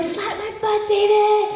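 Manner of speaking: very high, sing-song order; frantic director impression. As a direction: toss a direction to a co-actor in a high-pitched voice. Acts out her directions to co-actor.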